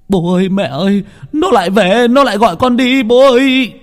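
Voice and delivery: high, loud panicked scream to his parents; terrified scream. Acted. Son screams to his parents after nightmare, terrified. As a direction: wake screaming in terror. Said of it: "hét lên".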